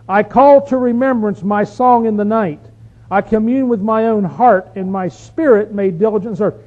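preacher reading scripture at strength. Acted. Reads the psalm aloud.

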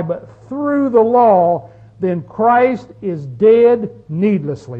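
measured, slow verse reading; slow deliberate scripture. Shown as a demonstration. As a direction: read the verse slowly, word by word. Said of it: "is what the language said".

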